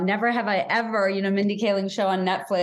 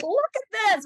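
high, delighted exclamation; a touch of proud delight, pitched up; proud excitement; 11 semitones higher than usual. Retold or imitated. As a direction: show off a friend's book, lightly voiced. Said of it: "I just feel like".

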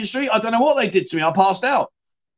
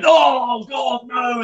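disgusted shout; 7 semitones higher, much slower than usual. Retold or imitated. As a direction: recoil and shout it. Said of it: "Guys going".

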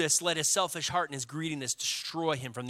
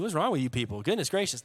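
ordinary talk, nothing marked

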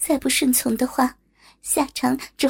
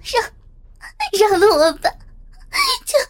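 loud, high, halting sobbed plea; sobbing; 9 semitones higher, 5 dB louder, faster than usual. A narrator's reading. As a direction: stammer through sobs, rising to a wail. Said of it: "忍不住哭泣着说".

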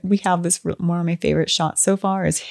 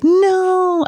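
drawn-out high "no" of disappointment; mock dismay; 13 semitones higher, 4 dB louder than usual. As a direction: wail it playfully, high and louder. Retold dismay at Tamara's death.

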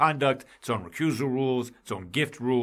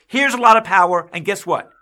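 mock offer; 6 semitones higher, 8 dB louder than usual. Mock offer to judges, heated.

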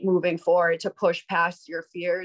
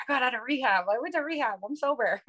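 higher, bubbly announcement of news; excited bragging; 5 semitones higher, faster than usual. Retold or imitated. Guest after rehab telling everybody, excited higher voice. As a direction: show off the big news with glee. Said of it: "of course, I'm like".

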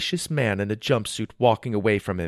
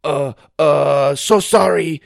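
put-on flustered Chinese accent, higher; 4 semitones higher, 7 dB louder, slower than usual. A narrator's reading. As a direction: stammer an apology in a Chinese accent. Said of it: "in a Chinese accent".

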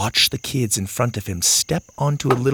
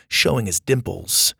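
plain reading voice

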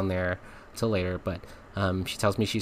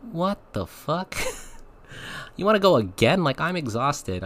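incredulous; 8 semitones higher than usual. Retold or imitated. Retelling his reaction when she wanted more, incredulous outburst. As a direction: react like you can't believe what you just heard. Disbelief at request to go again.

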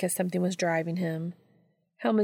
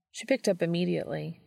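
ordinary delivery, nothing marked

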